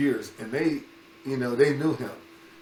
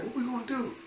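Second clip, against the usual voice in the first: much higher, small worried voice; panicked kids; 11 semitones higher, 6 dB quieter than usual. Retold or imitated. Boys hiding from the coach behind trees, mock panicked. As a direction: squeak it out like a scared kid hiding.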